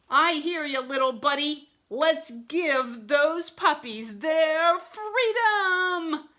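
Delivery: high, loud battle cry; rousing wolf hero. A narrator's reading. Mister Wolf freeing puppies, rousing heroic cry. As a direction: build to a long drawn-out final cry. Wolf rallies from his car.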